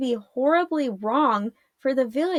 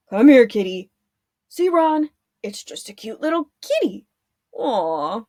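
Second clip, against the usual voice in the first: coaxing kitty; 3 dB louder than usual. A narrator's reading. Guard coaxes a cat.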